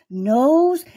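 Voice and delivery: ordinary delivery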